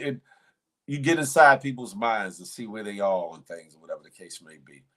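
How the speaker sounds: normal talking voice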